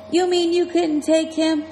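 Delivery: cheeky jab at a friend who dodged the fight; giving lip. A narrator's reading. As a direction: needle him with a cheeky question. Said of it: "I was giving him lip".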